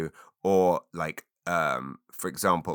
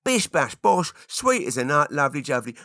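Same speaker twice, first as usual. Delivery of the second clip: higher, chirpy London slang patter; Cockney catchphrase; 7 semitones higher, 3 dB louder than usual. Shown as a demonstration. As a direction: rattle off Cockney slang cheerfully. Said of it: "I use it in sentences".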